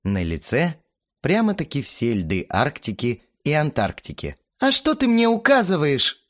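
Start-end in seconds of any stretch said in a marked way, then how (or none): 4.6-6.3 defiant. A narrator's reading. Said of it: "гордо вздёрнула подбородок Лена".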